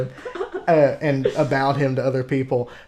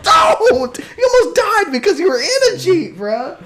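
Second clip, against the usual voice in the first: very high-pitched exasperated yell, half laughing; retold fuming; 3 dB louder than usual. Scolding brother who almost died in the Jeep, retold fuming. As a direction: squeal in outrage, re-enacting the row. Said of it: "I remember you were fuming".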